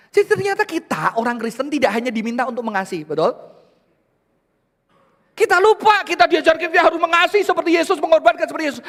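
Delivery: high, loud, fervent sermon cry; preaching at full force. Acted. Pastor urging the church to love each other, loud and high. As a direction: rouse the congregation.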